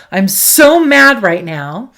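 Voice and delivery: loud, drawn-out stress on "so"; venting anger. Shown as a demonstration. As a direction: let the anger out without aiming it. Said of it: "let's say I wanna yell".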